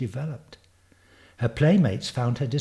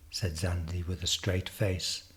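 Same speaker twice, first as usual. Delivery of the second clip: ordinary delivery, nothing marked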